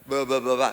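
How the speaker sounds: loud mock baby babble ba ba ba; baby babbling. Shown as a demonstration. Pastor imitating a baby's first word, loud babble. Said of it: "¿qué palabra sea?".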